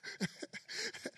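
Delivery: breathy laugh. Real, not acted. Laughing during demonstration.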